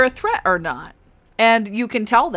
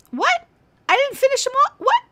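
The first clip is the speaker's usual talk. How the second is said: sudden high startled what; surprised. Real, not acted. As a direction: caught off guard, voice shooting up. Enemy unexpectedly still alive.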